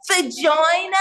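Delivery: high, incredulous echo in exaggerated Australian; mimicked Australian accent. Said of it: "When you say it in the accent".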